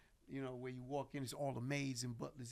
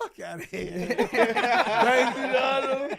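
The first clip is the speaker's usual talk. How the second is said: loud laughing through the words; cracking up while telling it; howling with laughter; laughing hard; 9 semitones higher, 17 dB louder, much slower than usual. Real, not acted.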